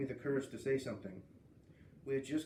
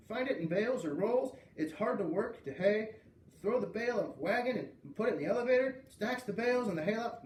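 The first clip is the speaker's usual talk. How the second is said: higher, rapid-fire farm facts; excited boy; 8 semitones higher, 4 dB louder than usual. A narrator's reading. Boy spouting farm facts at pictures, eager and high. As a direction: rattle off facts, thrilled.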